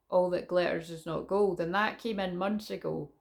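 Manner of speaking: ordinary talk, nothing marked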